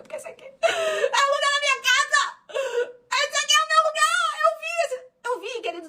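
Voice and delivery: high, loud tearful cry. Retold or imitated. Mother crying to her son over the land.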